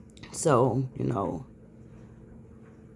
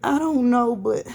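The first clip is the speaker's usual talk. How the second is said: higher, strained, fighting to stay calm; like she's trying not to break down; upset, holding it together; 4 semitones higher than usual. Real, not acted. Mother venting to viewers after son's ambulance ride, shaky strained voice. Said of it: "I'm calming myself down".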